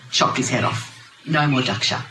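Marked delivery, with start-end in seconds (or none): none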